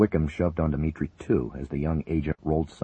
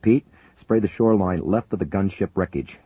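ordering; 5 semitones higher, 5 dB louder than usual. Acted. Pilot giving fire orders.

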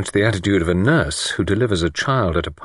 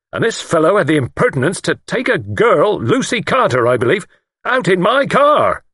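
high, outraged complaint ending loud; indignant; 11 semitones higher, 5 dB louder than usual. A narrator's reading. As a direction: pile up the outrage to the end. Lord indignant about chauffeur's outing.

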